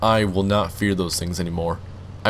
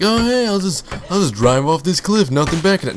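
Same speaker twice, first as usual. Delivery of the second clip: mock carefree self; 8 semitones higher, 3 dB louder than usual. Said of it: "I was like".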